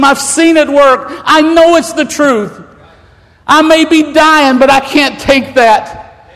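preacher voicing the dying woman who defends him; preaching fervour. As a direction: testify it for her, building to the truth.

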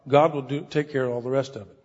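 ordinary delivery

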